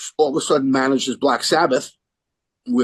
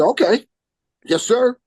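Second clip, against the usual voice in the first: high, louder, keen to obey; eager yes sir; 8 semitones higher, 5 dB louder than usual. Retold or imitated. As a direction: answer like a thrilled fan. Eager reply to [name].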